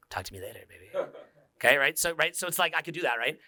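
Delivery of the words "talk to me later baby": very low, hushed ASMR-like voice; soft intimate murmur. Shown as a demonstration. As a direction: murmur low to give them tingles. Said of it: "Maybe if I talk like this".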